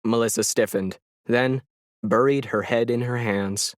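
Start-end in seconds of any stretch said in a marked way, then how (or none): none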